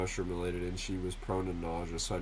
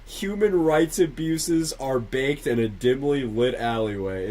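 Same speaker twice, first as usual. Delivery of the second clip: slow loud reading; 5 semitones higher, 10 dB louder than usual. Shown as a demonstration. Second take, slower.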